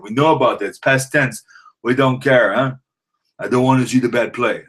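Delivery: high, cocky heckler jeering with a tag on each line; taunting trash talk. Acted. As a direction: rib the rival fans like a smug loudmouth. Trash-talks Packers fans in character.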